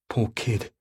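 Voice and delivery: ordinary delivery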